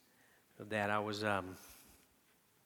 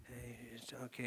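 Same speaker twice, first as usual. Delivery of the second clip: much softer gentle hush; motherly soothing; 11 dB quieter than usual. Retold or imitated. Father gently soothing his crying newborn, soft. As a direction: soothe the baby tenderly. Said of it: "the motherly, gingerly".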